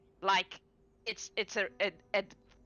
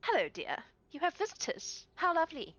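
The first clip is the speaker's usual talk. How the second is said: higher, sugary hostess greeting; sweet hostess; 9 semitones higher than usual. Acted. Shalana greeting her husband's visitors, higher and sugary. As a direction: coo a welcome to the guests. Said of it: "And she goes".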